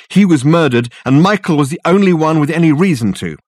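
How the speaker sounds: high, loud agitated rush; frantic. A narrator's reading. Richard explaining the murder to Dirk, frantic and loud. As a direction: panic spilling out in a torrent.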